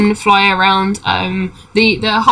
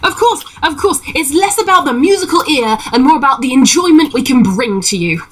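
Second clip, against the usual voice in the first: high, eager assurance; reassuring halfling showman; 5 semitones higher than usual. Acted. Halfling persuading a doubtful listener, high eager voice. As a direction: insist cheerily in a high halfling voice.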